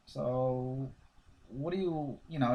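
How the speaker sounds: ordinary talk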